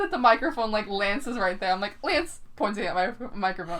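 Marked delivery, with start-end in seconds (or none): 2.0-2.4 high-pitched imitation of grandma calling; playful high grandma voice; grandma impression. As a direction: call the name as grandma would. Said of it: "Do your impression".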